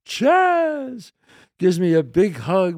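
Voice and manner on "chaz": high, drawn-out happy call; smiling, delighted greeting. Retold or imitated. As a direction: imitate someone thrilled to spot a friend. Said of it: "and he smiles".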